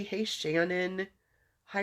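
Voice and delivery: ordinary delivery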